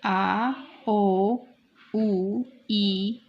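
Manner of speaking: आ, ओ, उ, ई each held long and separate; vowel demonstration. Shown as a demonstration. Teacher listing the German vowels आ, ओ, उ, ई, drawn out. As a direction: sound out आ, ओ, उ, ई one by one. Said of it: "vowels को कहेंगे".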